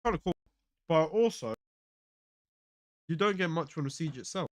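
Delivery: talking through a mouthful; words round a bite of food; mouth full. Real, not acted. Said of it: "Sorry for my full mouth".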